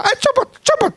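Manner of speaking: very high startled yelp; sudden high mock squeal; imitated latah outburst. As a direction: mimic an involuntary startled blurt. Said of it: "sempat dia keluar lata".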